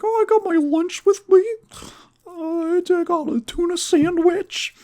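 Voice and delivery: very high falsetto little boy impression; mock little boy. Sherman showing his lunch to the girl, mock high boy voice. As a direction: squeak it out in a put-on falsetto. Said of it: "And he's like".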